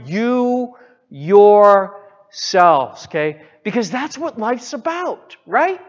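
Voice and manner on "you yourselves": loud, hugely stretched "you yourselves"; stress on YOU YOURSELVES. Shown as a demonstration. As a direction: hammer "You yourselves" very slowly, word by word. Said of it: "the emphasis is on you".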